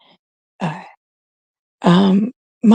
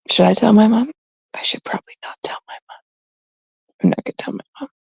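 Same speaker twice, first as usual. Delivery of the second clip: conspiratorial whisper; 2 semitones higher, much faster than usual. Real, not acted. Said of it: "debating telling mom".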